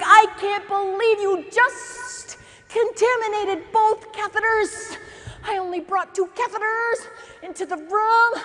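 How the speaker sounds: hammed-up furious instructor, dragging the words out; yelling. Instructor yells at the student over the patient, mock-furious. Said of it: "now he starts yelling at me over the patient".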